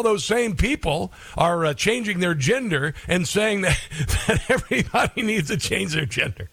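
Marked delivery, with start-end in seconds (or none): 3.9-6.5 words broken up by real laughter; laughing. Real, not acted. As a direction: laugh through the line. Host laughing at his own point.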